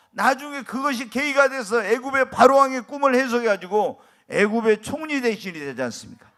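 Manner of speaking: loud, rising sermon proclamation; fervent preacher building to a climax; preaching at full force. Acted. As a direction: thunder it out to the congregation.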